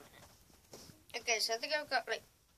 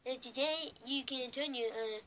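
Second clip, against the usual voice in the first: higher-pitched cartoon school teacher voice; Mister Mackey; 4 semitones higher, 3 dB louder than usual. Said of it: "I think it's Mister Mackey".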